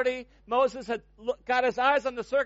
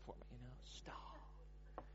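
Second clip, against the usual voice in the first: whisper; 11 semitones lower, 29 dB quieter, slower than usual. Real, not acted. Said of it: "whispered aside about the word".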